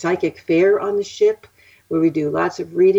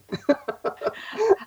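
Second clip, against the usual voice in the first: soft laugh; 13 semitones higher, 5 dB quieter than usual. Real, not acted. Laughs after telling the anecdote.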